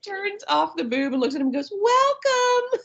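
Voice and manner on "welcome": put-on high squeaky little kid voice; child greeting. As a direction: chirp it like a small boy. Toddler greeting from the breast.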